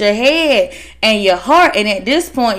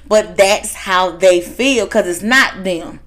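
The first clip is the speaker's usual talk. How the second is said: ordinary talk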